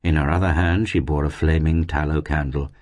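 ordinary delivery

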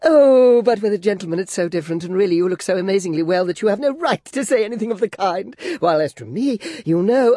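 put-on high, girlish, fluttering chatter; coquettish Flora. Flora fishing for compliments from Clennam, high and girlish. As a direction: play the flustered former belle.

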